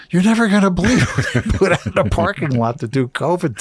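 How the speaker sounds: high, imitated laughing disbelief; howling with laughter. Check-in girl tells her coworker, imitated howling with laughter. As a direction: laugh it out high, as if sharing outrageous news. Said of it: "she starts howling".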